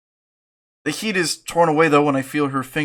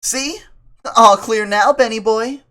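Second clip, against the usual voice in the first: put-on high girl voice; 8 semitones higher, 6 dB louder than usual.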